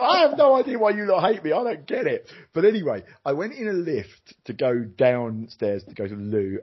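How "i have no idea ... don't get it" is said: high, pleading disbelief, pitched well up; much higher, bewildered cry of why do you hate me; bewildered plea. Retold or imitated. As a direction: ask why they hate you, honestly baffled. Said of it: "And I was like".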